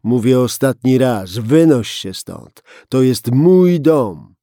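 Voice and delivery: loud, slowing, furious; final ultimatum. Acted. Gunman ordering the policeman out of his house, loud and slow. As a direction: drive it home word by word.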